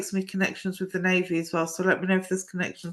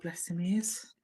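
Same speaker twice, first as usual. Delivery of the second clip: whispered aside; 9 dB quieter than usual. Real, not acted. Aside about elderly grandfather.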